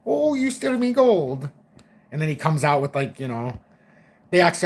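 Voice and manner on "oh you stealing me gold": high, slow menacing Irish leprechaun; slow, high creepy leprechaun voice; killer leprechaun. Retold or imitated. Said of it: "like a killer leprechaun".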